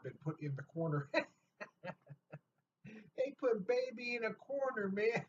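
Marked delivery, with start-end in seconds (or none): 3.2-5.2 high, laughing through the movie line; laughing quote. Real, not acted. Laughing as he jokes to viewers about being cornered. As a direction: giggle out the quote.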